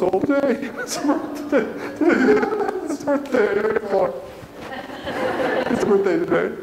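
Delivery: high, cracking voice full of tears; proud dad fighting back happy tears; choked up. Real, not acted. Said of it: "When I get choked up, I can't talk".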